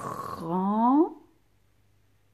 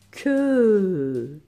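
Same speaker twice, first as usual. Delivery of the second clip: emphatic dictation; 7 dB louder than usual. Shown as a demonstration. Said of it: "Celui qui a deux lettres".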